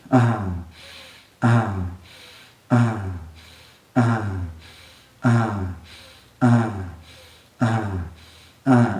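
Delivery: unhurried falling "uh" sighs with a breath between; relaxed voiced breathing demo; rhythmic sighing exhales. Shown as a demonstration. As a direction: sigh out each exhale in a steady, easy rhythm. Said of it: "So like this".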